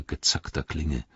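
ordinary delivery

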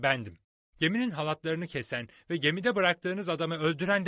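put-on young hero standing up boldly; bold young Jim. A narrator's reading. Jim confronts the pirates.

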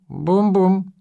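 high little monkey squeak. A narrator's reading. Martysh trailing after Oleg, high squeak. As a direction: pipe it up high like a small creature. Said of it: "пискнул".

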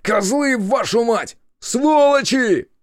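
high, loud cursing; enraged shout, last word drawn out; furious yell. A narrator's reading. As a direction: scream curses after the kidnappers. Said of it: "выкрикнул я".

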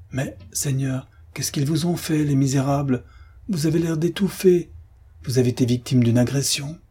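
high, anxious, fussing voice; alarmed concierge. Acted. Shocked concierge questions battered friend.

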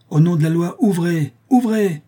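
high, loud order through a door; commanding shout. Acted. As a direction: demand entry in the law's name.

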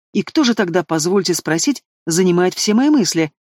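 usual speaking voice

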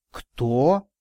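amazed question with a rising end; astonished exclamation. A narrator's reading. Man learning who the boy's informant was, astonished. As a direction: ask it in sudden disbelief. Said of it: "изумлённо воскликнул я".

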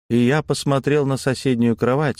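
plain reading voice

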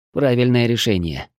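plain reading voice

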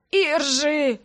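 hoarse, winded gasp of her name; wheezing. A narrator's reading. Brother winded after sister landed on him, wheezing. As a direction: croak it out winded. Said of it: "прохрипел брат".

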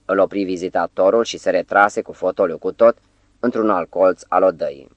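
plain reading voice

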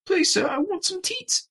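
Oliver Twist pleading. Pretend. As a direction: beg like a tiny workhouse orphan. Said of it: "imagining Oliver Twist with these just foul-mouthed urchins".

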